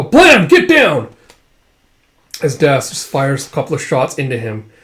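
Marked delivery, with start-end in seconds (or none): 0.0-1.1 shouted command. Acted. Gunshot and shout.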